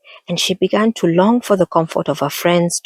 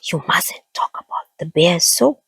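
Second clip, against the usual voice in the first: hushed warning in a whisper; worried whisper. A narrator's reading. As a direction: warn her under your breath. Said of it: "whispered one of her friends in alarm".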